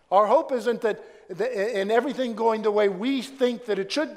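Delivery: loud, high preaching call; full-force preaching. Acted. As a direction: preach it out to the congregation.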